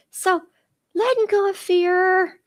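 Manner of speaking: ordinary delivery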